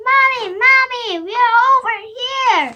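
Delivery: fast, loud high shouting; calling out. Acted. Lost children shouting to their mother's torch. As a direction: yell for Mom to see them.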